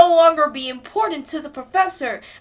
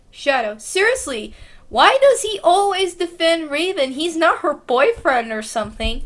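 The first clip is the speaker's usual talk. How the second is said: ordinary delivery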